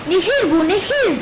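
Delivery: high, loud cry of discovery; joint excited shout. A narrator's reading. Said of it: "diye bağırdı".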